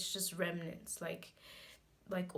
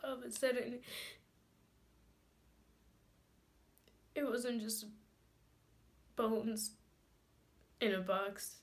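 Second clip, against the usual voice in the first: halting, higher voice with sobbing breaths; tearful grief; 4 semitones higher than usual. Acted. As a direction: let it out in broken pieces, long tearful pauses. Grieving monologue, crying subsides.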